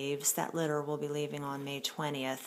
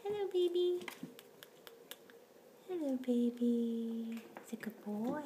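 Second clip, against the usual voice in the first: very high cooing to a kitten; 5 semitones higher than usual. Real, not acted. High baby talk to kitten.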